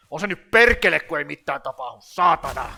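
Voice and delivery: loud, forceful Finnish outburst; barked Finnish swearing, full of oomph; oomph-filled Finnish. Retold or imitated. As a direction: curse it out with punch. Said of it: "Finnish is much more oomph".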